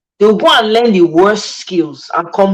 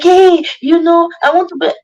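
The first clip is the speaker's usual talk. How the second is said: higher-pitched mocking imitation; mock put-on foreigner; 7 semitones higher than usual. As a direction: mimic a pretentious returnee, pitched up. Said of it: "they'll be like".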